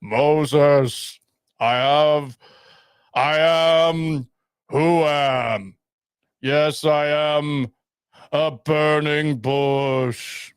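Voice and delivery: slow booming divine voice; voice of God. Pretend. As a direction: intone like God from the burning bush. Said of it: "You sounded like God speaking to Moses".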